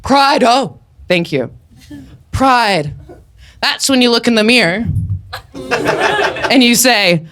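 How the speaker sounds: usual speaking voice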